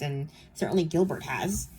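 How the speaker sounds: ordinary talk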